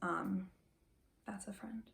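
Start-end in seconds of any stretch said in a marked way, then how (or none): 1.3-1.8 soft whispered aside. Real, not acted. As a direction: whisper it to close the thought. Soft closing line after pause.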